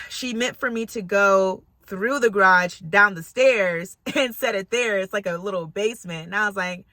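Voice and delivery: normal talking voice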